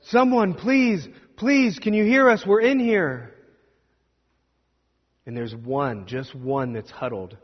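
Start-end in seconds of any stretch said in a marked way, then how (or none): 0.0-3.4 high, loud cry for help; desperate hollering. Acted. Trapped travelers shouting toward the light for rescue, desperate and high. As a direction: call out to anyone beyond the rock. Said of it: "they're hollering".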